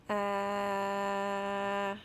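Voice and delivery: "Ah" drawn out long and steady; sustained sound. Shown as a demonstration. Teacher models the sound to learners, sustained. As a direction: hold "Ah" on one level note. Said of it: "I'll keep on saying it".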